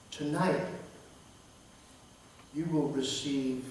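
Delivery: soft divine voice. Acted. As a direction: let each word fall softly like a secret. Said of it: "God whispered in my ear".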